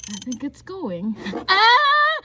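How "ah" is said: high, loud cry of fright; startled scream. Real, not acted. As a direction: scream as you slip. Almost slips.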